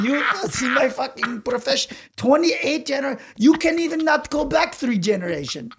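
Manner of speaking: annoyed tattoo artist. Acted. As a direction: play the offended craftsman. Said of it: "he's, like, annoyed".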